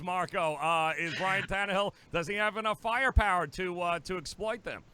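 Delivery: usual speaking voice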